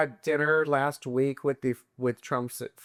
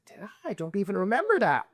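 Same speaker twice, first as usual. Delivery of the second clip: higher mock-innocent reply; baffled protest; 6 semitones higher than usual. Answering his angry girlfriend, high retold bafflement. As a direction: wonder aloud, pitched up in disbelief.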